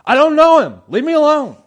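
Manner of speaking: loud, high-pitched desperate denial; frantic shout. Retold or imitated. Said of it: "then what are you doing".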